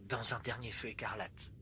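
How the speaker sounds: low, very soft ending; hushed refrain. Acted. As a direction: let it die away like embers. Refrain dying away.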